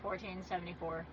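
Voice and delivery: mildly bored cashier imitation; no enthusiasm. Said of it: "no enthusiasm".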